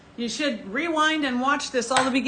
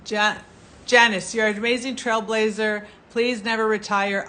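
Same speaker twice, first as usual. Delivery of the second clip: usual speaking voice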